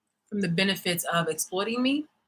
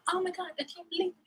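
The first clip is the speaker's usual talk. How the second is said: mock shock; 9 semitones higher, 9 dB quieter, much faster than usual. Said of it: "y'all wanna start talking about".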